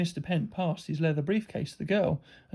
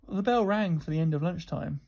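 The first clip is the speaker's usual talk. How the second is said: plain reading voice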